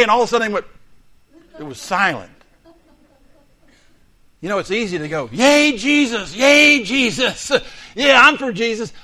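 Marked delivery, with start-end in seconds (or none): 5.3-8.9 slow, high, loud hammed-up cheer; mock cheering. Shallow believers cheering for Jesus, hammed-up loud cheer.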